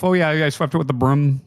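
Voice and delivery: lightly voiced North Midwest local; light Minnesota accent. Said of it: "the roof".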